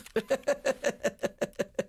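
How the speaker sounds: high, real burst of laughter. Real, not acted. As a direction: laugh it out at the funny moment. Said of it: "That's freaking hilarious".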